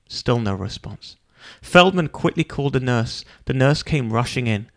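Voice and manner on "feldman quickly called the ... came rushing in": ordinary delivery, nothing marked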